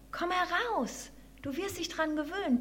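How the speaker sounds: high, small mouse voice; piping mouse. A narrator's reading. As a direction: coax softly in a little squeak. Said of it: "piepste die Maus".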